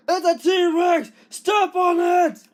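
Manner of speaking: high, shouted cries of panic; squeaky toy figures yelling in terror; panicked screaming. Pretend. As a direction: scream for help as the monster charges. Toy figures flee a T-Rex.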